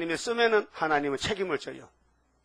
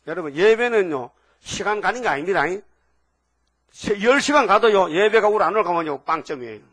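louder, driving sermon voice; fiery preaching; 5 dB louder than usual. Acted. Pastor urging the congregation about true worship, fiery preaching. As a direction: hammer the point home to the pews.

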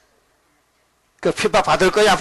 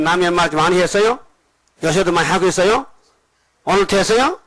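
ordinary talk

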